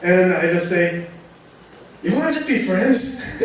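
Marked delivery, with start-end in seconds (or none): 2.0-3.4 laughing retold question. Friend imitates Ian's offer.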